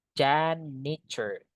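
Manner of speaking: Janitor drawn out slowly, syllable by syllable; slow model word. Shown as a demonstration. Teacher repeating Janitor slowly so students catch it. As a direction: stretch Janitor out for learners. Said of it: "Ini bacanya".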